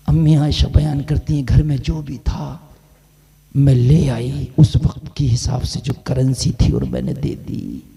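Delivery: low, measured sermon voice with long pauses; solemn storytelling. Retold or imitated. Preacher voicing the Prophet's request to Ayesha, low solemn storytelling. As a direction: sink low and space out each phrase.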